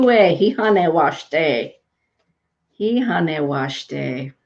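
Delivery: hihane washte said very slowly, syllable by syllable; Lakota greeting. Shown as a demonstration. Greeting a viewer named Chue in Lakota, very slow. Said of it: "Hey, Chue".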